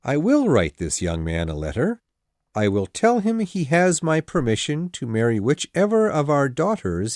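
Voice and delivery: low, measured, dry husband; unhurried, deep-voiced Mr. Bennet; calm. A narrator's reading. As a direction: calmly lay out your plan. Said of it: "said Mister Bennet calmly".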